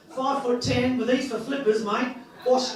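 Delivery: normal talking voice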